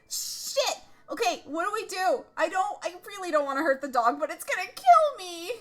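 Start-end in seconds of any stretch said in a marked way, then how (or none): none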